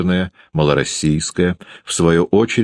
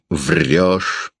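plain reading voice

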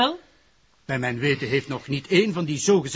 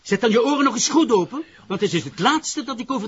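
louder, higher and fast, fed up; losing patience; 7 semitones higher, 4 dB louder than usual. Acted. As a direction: snap at him to finally listen. Prosecutor loses patience with detective.

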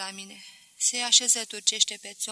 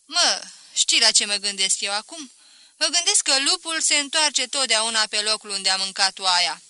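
loud, familiar jibe; needling mother-in-law; 8 dB louder than usual. Acted. Mother-in-law hints to her daughter's old flame, loud needling. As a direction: needle him loudly with a pointed saying.

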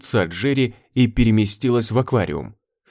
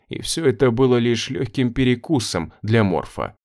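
usual speaking voice

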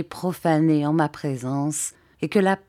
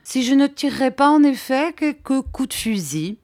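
high-pitched, musing uncertainty; affected wavering, pitched up; feigned indecision; 8 semitones higher, 3 dB louder than usual. A narrator's reading. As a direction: muse aloud as if still undecided. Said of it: "en prenant un air d'indécision".

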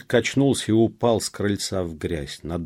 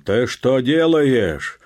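loud, high, drawn-out challenge; menacing shout; 6 semitones higher, 9 dB louder, slower than usual. A narrator's reading. Peasant stopping the officer, menacing shout. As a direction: challenge him at full voice. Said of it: "крикнул грозно Петро".

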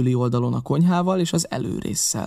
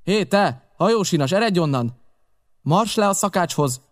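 put-on high, raised call to the ship's boy; shouted order; 6 semitones higher than usual. A narrator's reading. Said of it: "rám kiáltott". (magas hangú, parancsoló rákiáltás)

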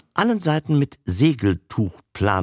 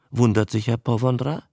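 plain reading voice

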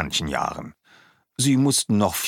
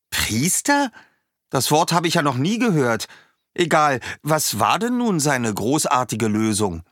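puzzled bird; 7 semitones higher than usual. A narrator's reading. Schwalbe hears a new word.

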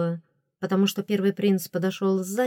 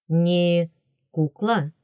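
«Не кукла?» asked back warily, with a falling end; careful, doubting «Не кукла?»; cautious question; 4 dB louder, much slower than usual. A narrator's reading. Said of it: "осторожно переспросил он". (осторожное переспрашивание «Не кукла?»)

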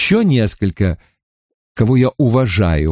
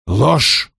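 ледяным тоном; 2 semitones higher than usual. A narrator's reading. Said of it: "ледяным тоном возразил Вульф".

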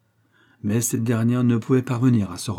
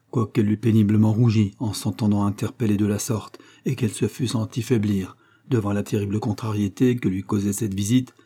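usual speaking voice

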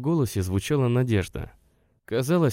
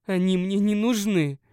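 higher, mildly angry and stubborn; a touch of stubborn spite; 11 semitones higher than usual. A narrator's reading. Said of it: "зло и упрямо сказала она". (зло и упрямо, сдержанно)

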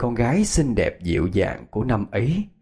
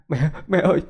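high, pleading cry; tearful outburst; 9 semitones higher, 3 dB louder than usual. A narrator's reading. Son kneeling before his dead mother, tearfully begging forgiveness. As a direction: voice climbing as he begs through tears. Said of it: "anh òa lên khóc".

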